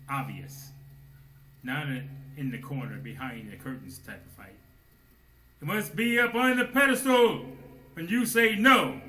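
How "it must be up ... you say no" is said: loud, high, slowing on the last words; full-force preaching. Acted. Preacher urging his brethren to fight, loud high preaching. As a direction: preach it up onto the pedestal.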